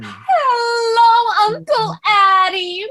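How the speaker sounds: high, loud gushing hello; bright theatrical niece greeting; effusive greeting. Acted. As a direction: gush over your uncle.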